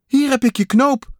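high, loud excited call; excited find. A narrator's reading. Kikker has found a button for Pat, excited high call. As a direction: announce it high, like a triumphant find.

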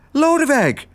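put-on very high girl's exclamation; delighted surprised call. Girl finding her mouse friend in the bag, high surprised cry. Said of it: "riep Otje".

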